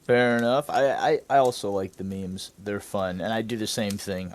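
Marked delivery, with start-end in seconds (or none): none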